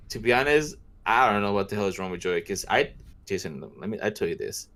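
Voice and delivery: ordinary talk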